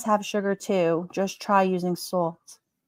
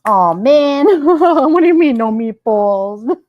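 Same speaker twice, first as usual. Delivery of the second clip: louder, higher, laughing protest; mock outrage; 5 semitones higher, 9 dB louder, slower than usual. To a viewer banning meatballs, laughing mock dismay. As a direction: protest through laughter, hammed up.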